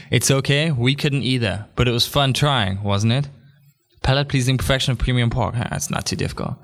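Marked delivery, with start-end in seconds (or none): 4.0-5.5 rattled off fast as a tongue twister. Shown as a demonstration. Host trying the bacon slogan fast for listeners. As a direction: race through "Palate-pleasing perfection of premium pork". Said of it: "Now say that five times fast".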